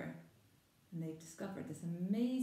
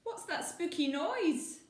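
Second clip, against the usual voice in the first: high, slow, frightened question; wide-eyed fear in the dark; scared child; 11 semitones higher, 5 dB louder, slower than usual. Acted. Maggie hearing a noise at night, frightened.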